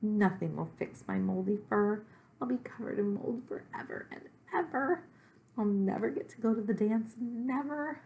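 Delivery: high, melodramatic lament; despairing Gorp, a little monster. Acted. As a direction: wail in despair, pitched well up.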